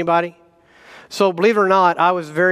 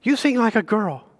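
lightly imitated junior high kid, a bit higher; a hint of a teasing kid, pitched up; 4 semitones higher than usual. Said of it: "kids in junior high that would come up".

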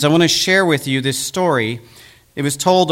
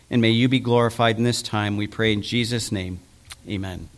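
hushed closing prayer; 4 semitones lower than usual. Acted. Opening prayer before sermon.

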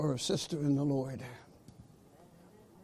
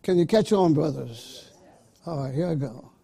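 ordinary talk, nothing marked

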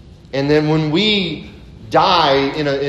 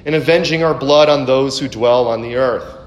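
high, loud pleading cry; loud lament, pitched high; 5 semitones higher, 4 dB louder than usual. Acted. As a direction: cry out to the Lord in anguish. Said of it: "they cried out with a loud voice".

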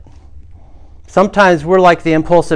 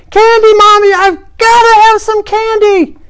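put-on child's tantrum; 7 dB louder than usual. Child begging Mommy in the candy aisle, screeching tantrum. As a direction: wail for it like a small child losing it. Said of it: "the child had another tantrum".

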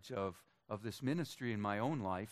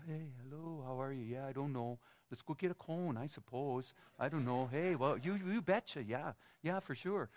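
higher, singsong Minnesotan small talk; Minnesotan accent; 4 semitones higher than usual. Acted. Preacher imitating his hometown neighbours, Minnesotan accent. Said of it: "My hometown Minnesotans".

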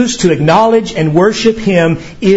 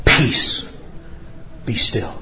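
hushed command; 8 semitones lower, 7 dB quieter, slower than usual. Acted. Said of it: "Jesus stands up and says".

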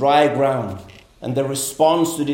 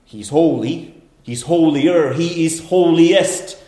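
rising louder and higher, slowing on holiest; building emphasis; 3 semitones higher, 4 dB louder, slower than usual. Shown as a demonstration. Preacher demonstrating the superlative, building step by step. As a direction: build each step up to the top. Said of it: "emphasized to the third degree".

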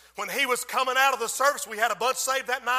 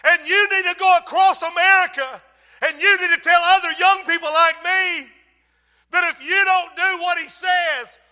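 put-on girl warning the preacher to tell America; desperate warning cry; 8 semitones higher, 12 dB louder than usual. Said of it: "She said".

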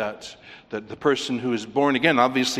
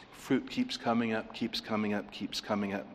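fading repetition; 3 semitones lower, 9 dB quieter than usual. Real, not acted. Repeated phrase fading away.

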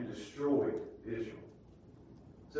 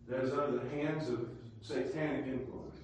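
ordinary delivery

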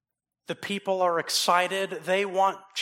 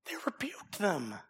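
breathy hushed repeat; hushed whisper; 2 semitones higher, 7 dB quieter than usual. Acted. Preacher to congregation, a hushed whisper. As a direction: whisper it, letting the rebuke sink in.

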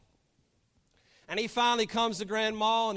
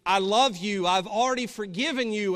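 loud, impassioned preacher's declaration; proclaimed forgiveness; 2 semitones higher, 8 dB louder than usual. Acted. Christ on the cross forgiving sinners, loudly proclaimed. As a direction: proclaim love out loud to everyone.

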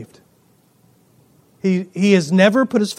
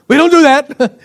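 laughing, higher and louder mock protest; mock Baptist refusal; 6 semitones higher, 6 dB louder than usual. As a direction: send up prim Baptists turning down a toast, half-laughing. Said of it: "us Baptists, we go".

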